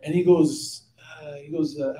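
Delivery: ordinary delivery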